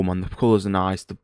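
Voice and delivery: usual speaking voice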